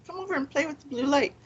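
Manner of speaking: soft, high, quick invitation; coaxing a child. Retold or imitated. Investigator inviting ghost girl to play, coaxing. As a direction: lightly coax a little one to play.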